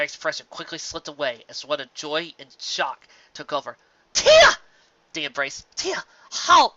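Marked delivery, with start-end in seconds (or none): 4.1-4.6 high, loud cry of joy; overjoyed shout of surprise; joyful shocked cry. A narrator's reading. Luna sees her lost sister. 5.7-6.7 high, loud, breathless disbelief; can't believe she's back; overjoyed disbelief; stunned joy. A narrator's reading. Luna stunned at sister's return.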